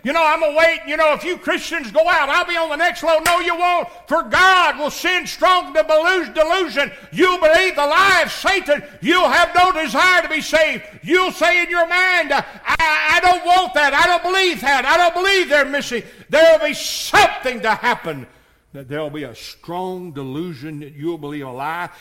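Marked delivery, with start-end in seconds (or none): 0.0-3.2 loud, high mock of a careless listener; mocking a stalling sinner. Preacher mocking listeners who put off salvation, shouted. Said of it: "You say". 3.2-18.3 loud, high shouted preaching; preaching at full force. Acted. Preacher warning of strong delusion, shouting.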